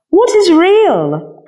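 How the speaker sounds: high, slow, wide-eyed little rabbit; rabbit's wondering question. A narrator's reading. Rabbit asks the skin horse what real means. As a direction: ask it like a small curious toy. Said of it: "asked the rabbit".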